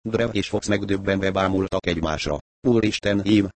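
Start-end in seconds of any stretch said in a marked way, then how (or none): none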